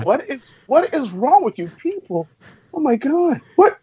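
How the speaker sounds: high-pitched, exasperated disbelief. Real, not acted. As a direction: plead with them, pitch jumping up in frustration. Exasperated at co-hosts' confused answers.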